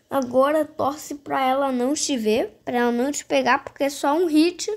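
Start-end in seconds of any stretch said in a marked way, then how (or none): none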